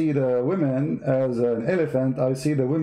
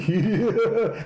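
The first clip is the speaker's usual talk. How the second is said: short mocking laugh; 8 semitones higher than usual. Real, not acted. Mocking the airport idea.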